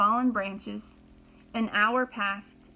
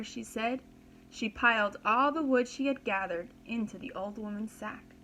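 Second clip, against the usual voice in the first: usual speaking voice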